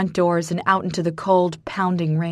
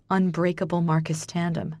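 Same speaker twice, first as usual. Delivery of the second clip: plain reading voice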